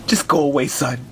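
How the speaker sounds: very low, weary voice; despondent dismissal. Acted. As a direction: send him away, sunk in gloom. Depressed father dismisses son.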